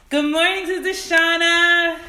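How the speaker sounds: joyful sung greeting; excited greeting. Real, not acted. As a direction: sing out good morning. Greeting viewer on stream.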